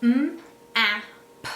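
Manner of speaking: "m, a, p" as separate sounds, each held apart; segmenting sounds. Shown as a demonstration. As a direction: tap out "m", "a", "p" one sound at a time. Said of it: "I would sound it out on my fingers".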